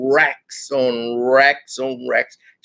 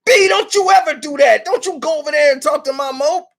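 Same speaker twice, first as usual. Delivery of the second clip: angry warning; 11 semitones higher than usual. Retold or imitated. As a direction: tell her off hard, pulled aside. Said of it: "snatched her and took her to the back and was like".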